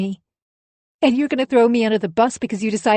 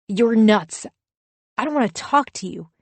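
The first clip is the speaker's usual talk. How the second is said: angry dismissal; 3 semitones higher, 4 dB louder than usual. Acted. Husband turns away in quarrel.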